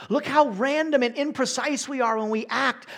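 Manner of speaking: loud, high exclamation; loud preaching. Acted. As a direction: cry out man's weakness. Preacher contrasting man and God.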